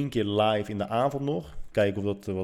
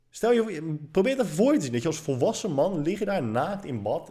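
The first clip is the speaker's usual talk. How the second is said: animated high-pitched telling; 8 semitones higher than usual. Real, not acted. Telling viewers about the bath, high and animated. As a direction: paint the scene for the viewers, pitch way up. Hamming up his embarrassment.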